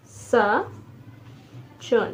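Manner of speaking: साचुन very slowly, with a long pause after sa; slow syllables. Shown as a demonstration. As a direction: dictate साचुन one syllable at a time. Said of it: "तीन तीन syllable बने हैं".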